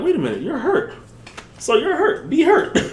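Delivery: mock self-talk. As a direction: talk yourself down in a sing-song tease. Mock inner voice to himself.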